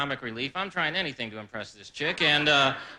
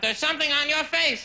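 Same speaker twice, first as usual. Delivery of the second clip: blurted warning; 10 semitones higher than usual. Retold or imitated. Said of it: "he looked at her and went".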